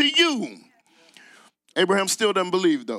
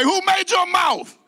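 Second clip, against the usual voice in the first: shouted to a neighbour; 10 semitones higher than usual. Shown as a demonstration. Preacher shouting the Lord's question at the congregation. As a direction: shout it at the person beside you. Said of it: "shout it like I shouted it".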